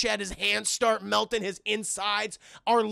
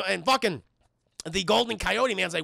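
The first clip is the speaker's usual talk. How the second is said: ordinary talk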